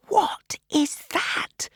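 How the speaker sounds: drawn-out entranced whisper; slow unvoiced whisper. A narrator's reading. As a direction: breathe it out, spellbound. Reaching for the pin.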